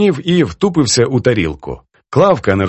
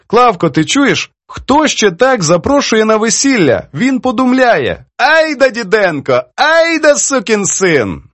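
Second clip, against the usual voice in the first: high, amazed exclaiming in character; delighted old poetess; 10 semitones higher, slower than usual. Acted. Poetess marvels to her friend at invitation, delighted. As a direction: draw out the words in high delight.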